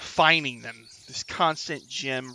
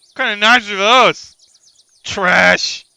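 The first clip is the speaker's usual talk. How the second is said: loud, higher, disgusted customer; scornful outburst; 7 semitones higher, 14 dB louder, slower than usual. Acted. Passer-by rejects the kobold's knives, loudly scornful. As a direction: shout your contempt for the goods.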